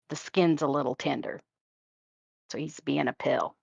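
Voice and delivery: usual speaking voice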